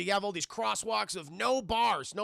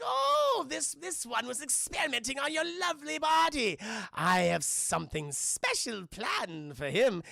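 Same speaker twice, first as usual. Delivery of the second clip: gloating leprechaun; 6 semitones higher than usual. Retold or imitated. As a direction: gloat like a cartoon villain, drawing it out.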